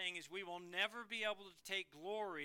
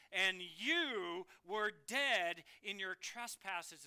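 slow emphatic reading; 6 dB louder, much slower than usual. Acted. Slow emphatic scripture quote.

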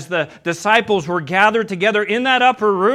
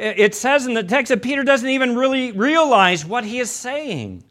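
usual speaking voice

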